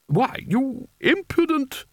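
very high, slow spluttering outrage; outraged. Acted. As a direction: splutter at the impudent boy. Spelter outraged at the boy.

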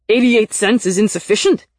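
loud, indignant outburst; furious. A narrator's reading. Nick reads the payment error on his screen, furious. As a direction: read it out in outraged disbelief. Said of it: "he read in a furious voice".

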